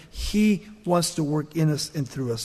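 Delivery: ordinary talk, nothing marked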